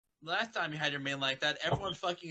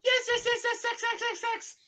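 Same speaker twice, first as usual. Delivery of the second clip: excited squeal; 5 dB louder than usual. Acted. Excited chant of yes.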